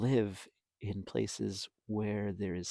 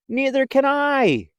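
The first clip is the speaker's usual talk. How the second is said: much higher, louder wail; howling; 12 semitones higher, 10 dB louder than usual. Acted. Flitter howling while lost in the dark. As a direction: wail in fright. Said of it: "howled Flitter".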